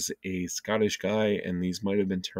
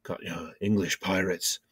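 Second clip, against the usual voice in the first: pirate accent. Shown as a demonstration. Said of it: "Sounds a bit like this".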